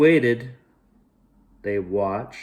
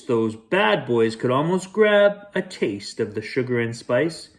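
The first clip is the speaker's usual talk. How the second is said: ordinary delivery, nothing marked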